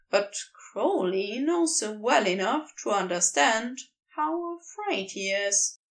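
usual speaking voice

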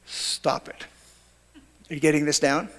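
flat near-whisper, no emotion; deadpan. Retold or imitated. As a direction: say it dry and hushed. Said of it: "He was a deadpan guy".

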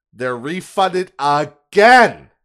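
slow down and blast the word again in exasperation; exasperated stress on again. Real, not acted. Customer learns fees were refunded again, exasperated stress on again.

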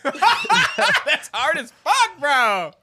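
laughing. Real, not acted. Friend reacting to a hard accent challenge, laughing. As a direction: laugh it out in disbelief.

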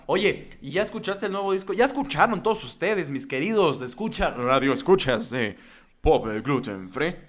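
radio announcer voice. Pretend. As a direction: greet the listeners like a radio host. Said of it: "tu voz de locutor de Radio Mamalón".

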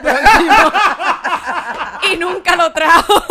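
loud, high words through laughter; cracking up. Real, not acted. Host teasing Cristina's broken promise, laughing hard. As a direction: struggle to finish through laughing.

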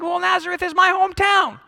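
high-pitched mock indignation; offended. Hometown local answering an insult, offended. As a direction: act put out on behalf of your town. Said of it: "you'd be offended by that".

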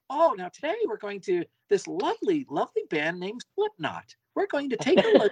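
put-on high, prim old-lady chirp; sweet granny voice. Host mimicking grannies introducing Slipknot, high prim chirp. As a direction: introduce the band like a sweet grandma. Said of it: "But they're like".

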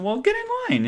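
very high-pitched quoted retort; teasing retort. Retold or imitated. Traveler teasing waiting colleague, high-pitched retort. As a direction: toss it back high, mock unsympathetic.

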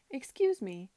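loud call upward. Acted. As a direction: call up to someone high. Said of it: "she called up to the coachman".

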